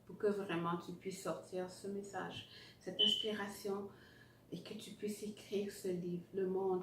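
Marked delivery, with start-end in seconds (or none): 4.5-6.2 whispering. Acted. Encouraging, drops to whisper.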